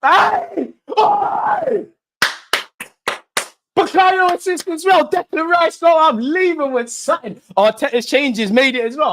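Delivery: very high, loud celebratory yelling; triumphant shout. Real, not acted. Fan answering the doubters after a goal, triumphant shouting. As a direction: scream the goal out, defiant and overjoyed.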